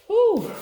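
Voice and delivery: high, loud excited whew. Real, not acted. Reader overcome with excitement for Aries, whew. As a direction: blow out a giddy whew. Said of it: "the level of excitement that I'm on".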